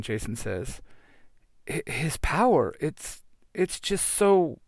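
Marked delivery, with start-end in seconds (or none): 1.7-4.6 shaken and halting. Acted. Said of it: "Kim says".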